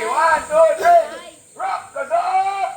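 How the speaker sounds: loud, rising count into a high chant call; rallying count-in. Real, not acted. Leader starting the campers' chant, shouted.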